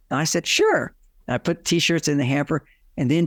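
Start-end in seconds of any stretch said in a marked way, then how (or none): 0.5-0.9 eager sure. Retold or imitated. Husband eagerly agrees to help.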